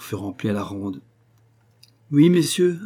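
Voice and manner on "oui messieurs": higher and louder, like a brass fanfare; boisterous guest cheering the toast, ringing loud; brassy fanfare voice. A narrator's reading. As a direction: blare it out like a trumpet call. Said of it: "d'une voix de fanfare".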